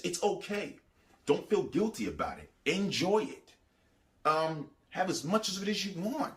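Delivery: a hint of a sly tempter offering permission; tempting voice. Devil's Bible message voiced.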